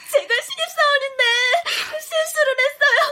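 sobbing as she begs for help; tearful, pleading voice; crying plea. Acted. New employee begs the winner through tears, sobbing. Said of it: "울지 마요".

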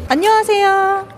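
high cheerful hello; bright greeting. Real, not acted. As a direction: greet them brightly, upbeat. Reporter greeting staff on site.

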